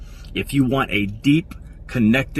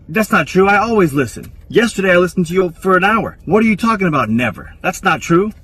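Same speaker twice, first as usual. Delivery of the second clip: higher, louder defensive protest; 8 semitones higher, 5 dB louder than usual. Retold or imitated. Man defending himself against his partner, higher and louder. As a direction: argue back like you're insulted. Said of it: "when you get defensive like that".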